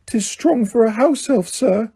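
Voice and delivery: high, small elf voice; Dobby defending Winky, high elf voice; house elf voice. A narrator's reading. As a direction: squeak it like a loyal house elf. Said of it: "he said".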